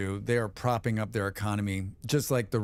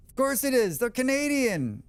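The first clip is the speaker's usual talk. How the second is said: mock sarcastic; 11 semitones higher than usual. Repeated sarcastic catchphrase.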